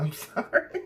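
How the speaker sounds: giggling through an apology; laughing apology. Real, not acted. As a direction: laugh it off, sorry. Laughs at herself.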